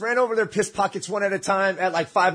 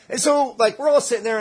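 ordinary talk, nothing marked

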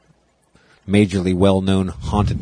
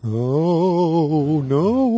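high, drawn-out ghost wail; wavering ghost voice; 6 semitones higher than usual. Pretend. Co-hosts joking about who invented it, wavering ghost voice. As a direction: wail it like a spook from a cartoon. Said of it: "the wavering ghost voice".

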